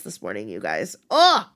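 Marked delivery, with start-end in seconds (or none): 1.1-1.5 high, loud whoop; host cheering her own apology, high loud whoop; excited cheer. Real, not acted. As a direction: cheer it like a win. Celebrating a real apology.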